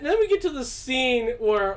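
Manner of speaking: ordinary talk, nothing marked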